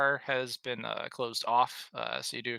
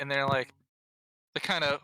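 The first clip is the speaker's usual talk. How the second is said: usual speaking voice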